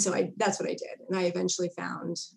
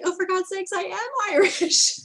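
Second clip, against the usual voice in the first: exasperated; 12 semitones higher, 6 dB louder than usual. Retold or imitated. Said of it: "finally I was like".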